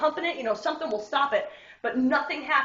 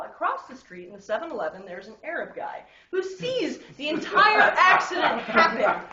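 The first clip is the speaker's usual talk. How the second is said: usual speaking voice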